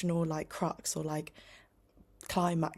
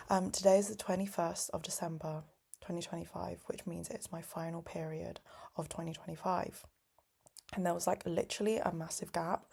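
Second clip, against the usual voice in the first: normal talking voice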